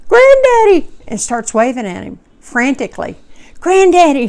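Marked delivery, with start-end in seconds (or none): 0.1-0.9 put-on high, excited child's call, rising each time; high-pitched toddler shout building up; child screaming Granddaddy. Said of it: "and screams". 3.6-4.3 put-on child calling. Child waving at ghost.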